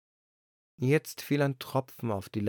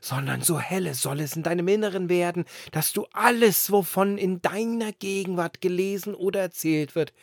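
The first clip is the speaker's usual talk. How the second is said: loud blustering wind; 7 semitones higher, 5 dB louder than usual. A narrator's reading. The wind boasting to the lantern, booming. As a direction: wind voice, higher and louder.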